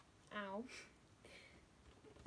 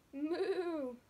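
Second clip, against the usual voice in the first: mock cow moo; 5 semitones higher, 5 dB louder than usual. A narrator's reading. Duck imitates a cow.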